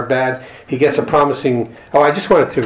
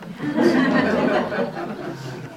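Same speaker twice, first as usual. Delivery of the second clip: a bright burst of real laughter, high up; 14 semitones higher, 5 dB quieter than usual. Real, not acted. Laughing at his own bar joke, real laugh. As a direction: laugh at your own punchline.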